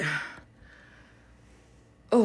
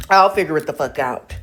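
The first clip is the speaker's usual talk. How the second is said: louder frustrated declaration; 3 semitones lower, 8 dB louder than usual. Real, not acted. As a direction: punch it out, annoyed.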